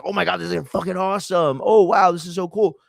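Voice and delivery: gushing fan, pitch jumping up; mock excited fan. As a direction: gush like a thrilled wrestling fan. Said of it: "We'd be like".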